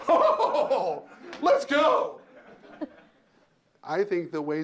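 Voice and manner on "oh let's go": high, loud, laughing oh, let's go; excited laughing cheer. Acted. Boss cheering staff on during a rush, laughing and hyped. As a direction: burst out in gleeful hype.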